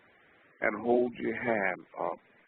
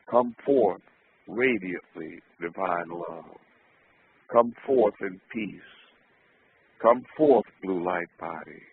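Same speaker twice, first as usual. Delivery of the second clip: commanding call, then softening; solemn invocation; 6 dB louder than usual. Acted. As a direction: summon it with conviction. Invocation chant, repeated.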